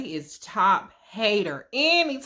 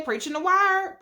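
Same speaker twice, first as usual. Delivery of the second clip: hyped church call; 8 semitones higher than usual. Retold or imitated. Hyping the uncle's speech.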